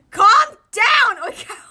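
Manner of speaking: loud high shout. Acted. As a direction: shout to stop the panic. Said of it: "Iwazumi shouted".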